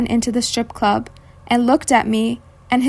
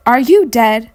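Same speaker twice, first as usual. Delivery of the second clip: raised voice, leaning on YOU in "Are you dead"; louder retold question; 6 dB louder than usual. Stripper questioning the silent-looking visitor a second time, loudly. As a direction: ask it again, louder and more insistent. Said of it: "but this time louder".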